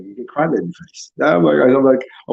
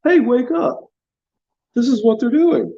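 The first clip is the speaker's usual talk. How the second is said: high-pitched mocking wake-up call; mock alarmist; 11 semitones higher than usual. Alarmist urging people to wake up, mocking high voice. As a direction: imitate a nagging truther, pitched way up. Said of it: "these people that are always like".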